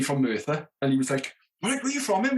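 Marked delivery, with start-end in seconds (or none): 1.6-2.4 put-on Welsh accent. Stagehand from Merthyr greeting.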